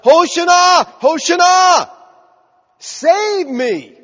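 loud, high cry with drawn-out final syllables; yelling for help. Acted. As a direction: yell it out like someone drowning. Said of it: "they're yelling out".

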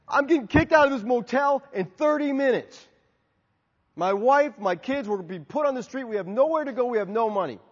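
retold urgent plea, high and loud at the start; mildly acted desperation; desperate plea. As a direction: plead for help under pressure. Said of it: "he was desperate".

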